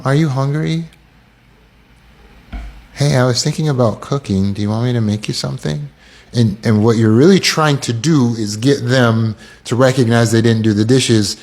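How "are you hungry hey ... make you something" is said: hesitant, softened mock offer; too passive. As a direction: tiptoe around the real issue. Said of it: "you assert yourself too passively".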